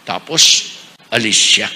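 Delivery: normal talking voice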